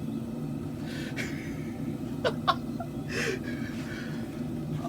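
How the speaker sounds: long, soft, wheezing laugh; high breathless laughter; laughing fit. Real, not acted. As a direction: crack up until only wheezes come out. Cracking up at own accent bit.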